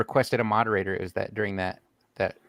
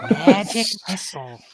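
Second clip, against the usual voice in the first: higher, louder spell cast; wizard spell call; 9 semitones higher, 3 dB louder than usual. Acted. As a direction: announce the spell like a nerdy wizard. Shouting a D and D spell.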